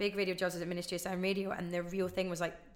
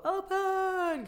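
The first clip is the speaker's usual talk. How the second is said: much higher, loud cry; opening shout; 12 semitones higher, 4 dB louder than usual. Retold or imitated. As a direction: belt it out to open the show. Caller opening the show.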